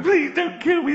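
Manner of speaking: high, loud mock scream for mercy; mock pleading. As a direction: squeal like a victim begging in the pot.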